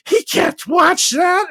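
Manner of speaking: hammed-up scolding girlfriend. Girlfriend tells him off about the show, in a high slow mock scold.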